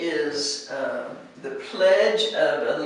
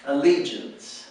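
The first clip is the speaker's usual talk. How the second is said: Allegiance with the weight on the middle syllable, le; second syllable stress; 2 semitones lower than usual. Shown as a demonstration. Teacher shows where the stress falls in Allegiance. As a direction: lean on the le in Allegiance. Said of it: "the accent is on the second syllable".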